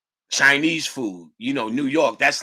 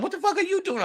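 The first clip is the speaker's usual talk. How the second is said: surprised; faster than usual. Retold or imitated. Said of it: "She's like".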